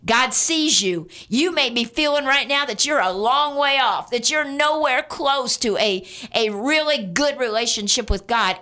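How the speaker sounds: ordinary talk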